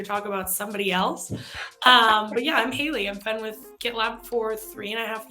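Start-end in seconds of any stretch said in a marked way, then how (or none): none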